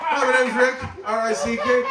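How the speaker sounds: mock show-opening introduction, slightly hammed up; reality show intro. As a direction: introduce yourself like an Intervention subject on camera. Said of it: "how the episode of my intervention episode starts".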